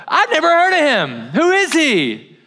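high-pitched, hammed-up protest; mock denial. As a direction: stretch it out, overplaying total ignorance. Peter denies knowing Jesus.

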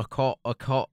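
practising British pronunciation of "cup"; tentative British accent. Shown as a demonstration. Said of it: "you just have to mimic it".

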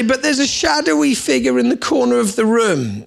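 put-on child telling Dad about a shadowy figure in the room; worried child. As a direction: play the kid pointing at a scary shadow. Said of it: "And then they'd say".

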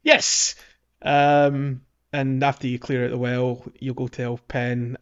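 usual speaking voice

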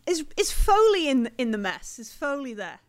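agitated question. Acted. Game master voices woman asking.

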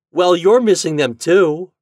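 high, loud comeback; retort. A narrator's reading. Toad answering Chuck's teasing, retorting loudly. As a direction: throw it back at him. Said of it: "retorted Toad".

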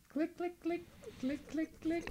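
high tiny click click clicks; playful typing noise imitation; mock clicking sounds. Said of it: "you might hear the little clicks of me typing".

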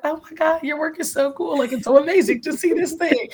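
put-on gushing high-pitched drunk girl; giddy party girl. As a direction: squeal it like a tipsy fan in the bathroom. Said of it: "another drunk girl in the bathroom".

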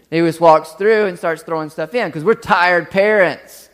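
ordinary delivery

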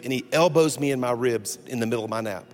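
ordinary delivery

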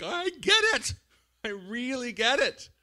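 very high, strained-up mock outburst; high, exaggerated show of sympathy; emphatic agreement. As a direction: agree at the top of your range. Acts emphatic sympathy with friends.

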